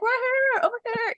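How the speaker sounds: high, bright call. Retold or imitated. Calling to the crowd for the ball, high. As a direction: sing out for the ball.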